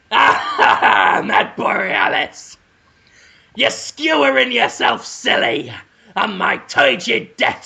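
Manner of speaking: high, slow, breathy-edged villain croon with laughter; hammed-up creepy villain. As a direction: purr it like a gleeful lecherous villain. Villain voice seducing victim.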